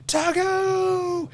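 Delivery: a high, gleeful greeting yell; calling out. Retold or imitated. Team room bros greet him with a high cheer. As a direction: shout his nickname like old friends at a bar.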